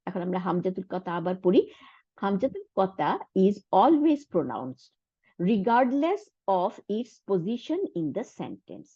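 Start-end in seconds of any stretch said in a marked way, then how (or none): 2.2-8.9 slow, careful textbook reading; slow reading aloud. Shown as a demonstration. Teacher reading an English rule slowly to students. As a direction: read the rule out word by word. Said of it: "reading the textbook rule".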